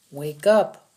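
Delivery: sharp loud order to wake up; a soldier shouting at a dazed captive to wake. Acted. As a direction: bark "Wake up" at him. Lopez slapping prisoner awake.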